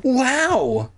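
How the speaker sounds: high, amazed exclamation; gasped. Acted. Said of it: "Sheena gasped".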